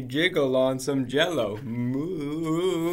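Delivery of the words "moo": long, jiggling, wavering moo; wobbly moo. Acted. Reader acts a cow jiggling on Jell-O, wobbly moo. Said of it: "moo sounds very wobbly".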